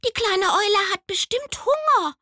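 hoch und laut, eifrig gerufen; rufendes Eichhörnchen. Acted. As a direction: ein flinkes Eichhörnchen ruft dazwischen. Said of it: "und rief". (hohe, laut rufende Eichhörnchenstimme)

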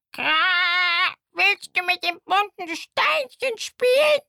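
a cheeky raven offering a shiny pebble. Acted. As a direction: caw it like a chatty raven. Raven speaks to crying owl.

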